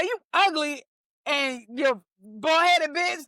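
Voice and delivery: high, drawn-out taunt; mock troll insult. Comedian showing what trolls say for attention, insulting. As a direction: hurl a slow, exaggerated insult.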